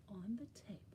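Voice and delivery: quick conspiratorial whisper. Acted. Storyteller teasing children, whispered. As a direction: let them in on a secret.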